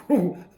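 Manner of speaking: loud exhale of relief; loud whew. Real, not acted. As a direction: blow out relief loudly. Relief after doctor leaves.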